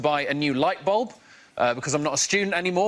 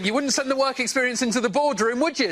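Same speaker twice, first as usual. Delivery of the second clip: normal talking voice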